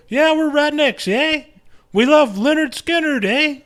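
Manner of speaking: put-on squeaky ham Canadian accent, end on a rising eh; hammed-up mock Canadian accent. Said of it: "Canadians dressed up like rednecks".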